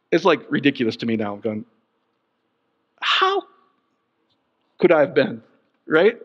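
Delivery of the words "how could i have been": mock disbelief. Pastor baffled by his own old belief, mock disbelief. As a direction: voice shooting up in bafflement at yourself.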